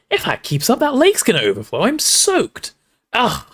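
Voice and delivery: exasperated grumbling. A narrator's reading. Ron shaking off water, exasperated and disgusted.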